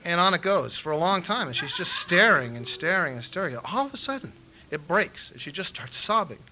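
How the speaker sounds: ordinary talk, nothing marked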